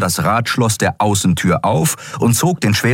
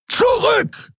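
very high, sudden yell; roared command. A narrator's reading. Kero orders Miko and Nelen back, roaring. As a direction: bellow a warning order. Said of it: "brüllte er Miko und Nelen an".

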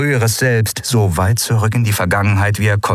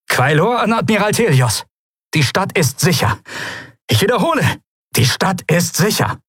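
high, projected military report; radio report; 10 semitones higher than usual. A narrator's reading. Commander telling the admiral the city is safe, calling. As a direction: report up the line, pitched high.

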